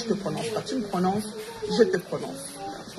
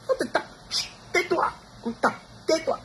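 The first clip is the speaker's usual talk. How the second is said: hushing parent; 9 semitones higher than usual. Retold or imitated. Voices parent hushing beaten child.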